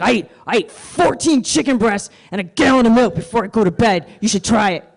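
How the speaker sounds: loud gym bro expecting to impress; boastful gym bro. Retold or imitated. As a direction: brag and wait for applause. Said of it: "he said it like I was supposed to be impressed".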